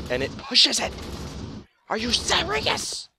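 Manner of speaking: high disbelieving squeal; shocked delight, voice jumping up; excited. Real, not acted. Bookcase finally moves, excited disbelief.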